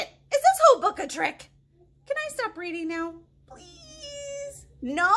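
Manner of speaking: pleading. Acted. Reader begging to stop.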